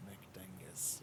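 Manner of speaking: slow whispered echo of the name; soft, airy mimicking whisper; hushed echo. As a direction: whisper it back, drawn out. Echoes the name under breath.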